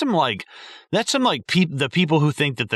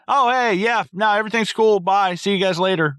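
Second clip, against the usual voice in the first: much higher, louder, breezy voice of a clueless guy; dopey exterminator; 11 semitones higher, 3 dB louder than usual. Retold or imitated. Exterminator leaving the kids, high and breezy. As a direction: wave it off cheerfully like nothing is wrong.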